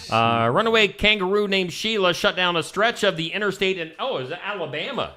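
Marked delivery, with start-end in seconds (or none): none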